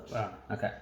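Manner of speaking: ordinary talk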